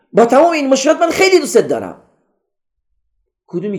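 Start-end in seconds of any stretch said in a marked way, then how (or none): 0.1-2.0 high-pitched, hurried love declaration; تند و با صدای بلند. Shown as a demonstration. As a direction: blurt it out high and fast. (تند، زیر و بدون احساس عاطفی)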